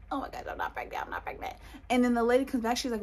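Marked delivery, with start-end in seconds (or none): 0.1-1.5 voiceless, breathy excited whisper; gasping whisper. Retold or imitated. Telling herself she is not pregnant, gasping whisper. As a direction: whisper it to yourself, overcome. Acting out secret relief after gasp.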